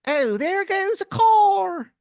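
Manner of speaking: put-on squeaky, high, slow caricature of a Philadelphia accent; terrible Philly accent. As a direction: grate it out in an over-the-top Philly accent. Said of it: "You'll just be like".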